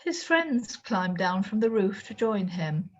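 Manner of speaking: usual speaking voice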